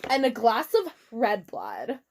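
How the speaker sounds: normal talking voice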